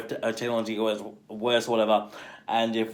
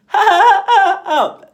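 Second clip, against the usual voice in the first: much higher, loud hammed-up cackle; imitated laugh; 11 dB louder than usual. Mimicking the Prime Minister's odd laugh, high loud cackle. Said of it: "the way he laughs is just like".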